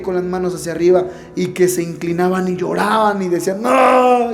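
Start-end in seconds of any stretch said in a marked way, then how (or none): 3.6-4.3 a high, loud cry of no; retold shout. Worshippers weeping at the service, a loud mock cry. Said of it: "y gritaban".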